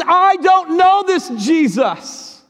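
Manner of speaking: very high, slow, strained cry; desperate denial. Acted. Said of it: "Peter says".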